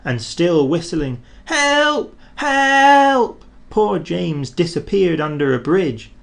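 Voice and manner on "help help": high, louder cries for help; a frightened engine shrieking from afar; calling for help. A narrator's reading. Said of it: "he heard an engine whistling".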